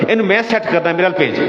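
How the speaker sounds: put-on high, pleading little boy voice; lightly voiced child asking to be passed over; small child. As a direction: beg like a five-year-old, pitched high. Small child begs to be handed over.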